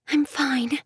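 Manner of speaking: whispered "I'm fine"; barely voiced, still shaken; breathy relief. Michelle reassuring the girls, whispering.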